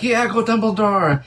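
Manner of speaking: high drawn-out cheer; excited cheer. Retold or imitated. Fan recalling a first viewing of the film, excited cheer.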